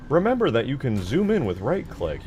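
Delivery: high, loud on-screen hint readout; imitated game message. Streamer quoting a hud message to viewers, loud mock prompt. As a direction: call it out like a game tip. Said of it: "where it's always like".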